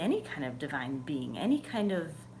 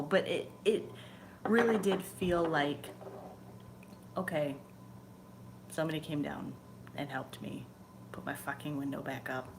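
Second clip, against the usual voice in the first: ordinary talk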